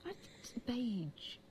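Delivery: slow whispered BEIGE; whispered demonstration. Shown as a demonstration. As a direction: whisper it slowly, no voice. Quietly repeats disputed word.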